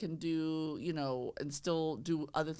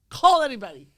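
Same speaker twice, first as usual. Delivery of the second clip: loud exhortation; 5 semitones higher, 15 dB louder than usual. Real, not acted. Host shouting at agents to call anyone, loud exhortation. Urging new agents to phone.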